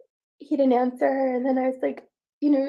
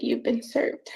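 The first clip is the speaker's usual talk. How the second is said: hushed retold line; whispered quote; slower than usual. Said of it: "and she said".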